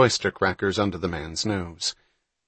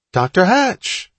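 much higher, louder, slow call across a crowd; 11 semitones higher, 7 dB louder, slower than usual. A narrator's reading. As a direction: hail him from a distance. Calling out across the pavilion.

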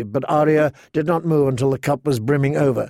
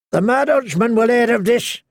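higher, warning townsman; mild threat; 8 semitones higher than usual. Acted. A townsman warns the outlaws, higher and threatening. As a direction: warn them of the Dutchman. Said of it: "a man threatened".

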